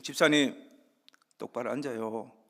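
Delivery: retold scolding call, loud burst on the first word; 고함치는 흉내. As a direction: call out sharply to pull up a slouching churchgoer. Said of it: "막 이렇게 막 고함을 지르고". (호통치는 목사 흉내)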